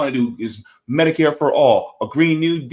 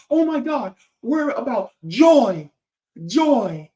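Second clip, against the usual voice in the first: high-pitched, drawn-out mock enthusiasm; mocking gushing; 10 semitones higher, 3 dB louder, slower than usual. Mimicking what everybody kept saying about Harris, mocking gushing. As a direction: gush it high, making fun of the fans.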